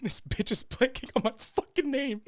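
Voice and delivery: high-pitched mock disbelief; baffled. Driver's inner rant about forgotten name, high baffled voice. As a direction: let the bafflement push your voice high. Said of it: "like, baffled".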